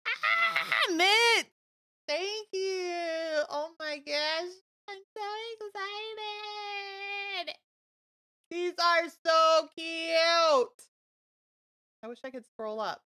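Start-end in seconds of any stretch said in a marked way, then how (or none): 0.1-1.5 high, loud delighted squeal; real excited scream; thrilled squeal. Real, not acted. Gift unboxing, thrilled squeal. 2.1-10.9 high, loud, drawn-out and near tears; real delighted tearful squeal; overjoyed teary gush. Real, not acted. Overwhelmed by gift, teary.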